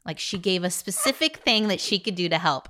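usual speaking voice

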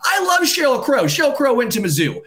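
high, heated ranting; worked-up fan raging about the snub; angry rant. Real, not acted. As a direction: vent real fury at the Hall of Fame. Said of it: "I'm angry".

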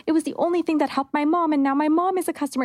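high, fast, excited gush; gushing customer. Retold or imitated. Customer messaging the brand, fast and high with excitement. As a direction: rush it out thrilled. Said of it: "people messaging being like".

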